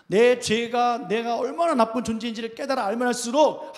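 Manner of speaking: loud, urgent pulpit delivery; forceful preaching. Real, not acted. Preacher presses home point about sin.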